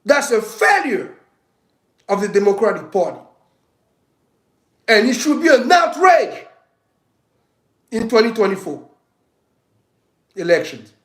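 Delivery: real heated rant building to a shout. Real, not acted. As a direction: let the anger boil over at the party. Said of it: "I think I'm getting heated".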